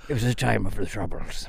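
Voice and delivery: put-on Irish accent. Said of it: "I love the Irish say that".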